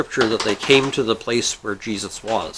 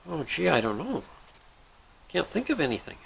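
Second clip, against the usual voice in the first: soft, higher, unsure voice; hesitant; 4 semitones higher, 10 dB quieter than usual. Acted. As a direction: dither quietly over the choice. Guy stuck on third wish.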